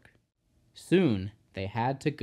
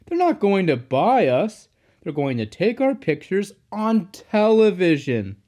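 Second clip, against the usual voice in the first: higher, louder reassurance; amused driver; 7 semitones higher, 7 dB louder than usual. A narrator's reading. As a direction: explain it loudly, putting the engine right. Driver cheerfully corrects the engine.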